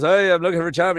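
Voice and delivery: high, loud, eager Newfoundlander; excitable Newfie job-seeker accent. Acted. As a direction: hail the boss in a Newfoundland accent. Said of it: "jump the pond over to Newfoundland".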